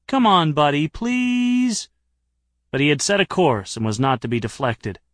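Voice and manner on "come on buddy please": whining, pitch climbing high on please; whiny plea. A narrator's reading. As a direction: wheedle like a sulky child. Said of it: "and then, whinily".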